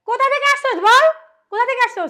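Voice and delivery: loud, high-pitched demand; angry interrogation. Acted. Woman confronts stranger found in bedroom, angry interrogation. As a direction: demand an answer, hard and loud.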